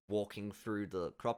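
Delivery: usual speaking voice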